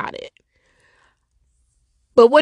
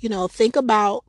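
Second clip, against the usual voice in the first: ordinary talk, nothing marked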